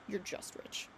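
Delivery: a last cold whisper to end it. Acted. Maid whispers the final insult to her rich mistress. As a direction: breathe it out without voice.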